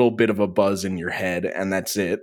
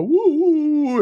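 mocking cheer; 4 dB louder than usual. Mocking the government's response.

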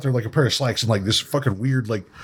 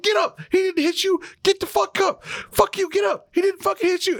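high mock yelling, much higher; imitating yelling. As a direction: scream at the fallen stuntman like Kevin Hart. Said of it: "is, like, yelling in this dude's face".

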